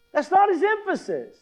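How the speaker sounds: "That's not his emphasis" in one high-pitched run; insistent correction. Real, not acted.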